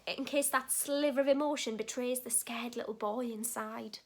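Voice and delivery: ordinary delivery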